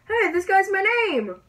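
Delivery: high, loud outburst of disbelief; indignant surprise. Real, not acted. Gamer spotting a player with the same name, indignant surprise. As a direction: call out in high-pitched protest.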